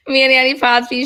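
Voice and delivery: loud, laughing name-drop; cracking up as she claims the dream. Real, not acted. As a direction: blurt it out through a laugh.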